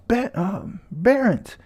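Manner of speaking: high shout of names; mother yelling names across the battlefield. Retold or imitated. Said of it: "she's yelling at them".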